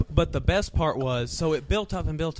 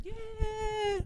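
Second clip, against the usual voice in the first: mock crowd cheer; 3 dB quieter than usual.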